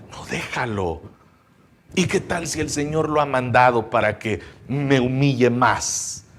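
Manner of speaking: soft, low, prudent reply; calm restraint. Retold or imitated. Changed David restraining his man, calm and quiet.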